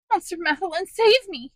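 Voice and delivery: high, piercing cry for help; anguished shriek. A narrator's reading. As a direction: shriek in terror to be saved. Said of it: "shrieked in anguish".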